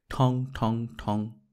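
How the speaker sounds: slow, drawn-out imitation of a brass gong: thong, thong, thong; gong clang imitation. A narrator's reading. Narrator imitating the hawker's gong on a quiet afternoon, slow and drawn out. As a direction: ring out each ঠং like a distant hawker's gong.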